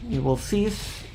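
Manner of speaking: mock-stern commands rising to anger; calm turning angry. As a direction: escalate from polite to furious. Demands escalate under fire.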